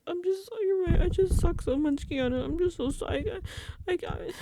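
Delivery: high, hammed-up pitiful whine; mock tearful grovelling. Vince curled up in a ball, mock pitiful whine. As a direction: apologise in a high, exaggerated whimper. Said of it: "like, in a ball on the floor".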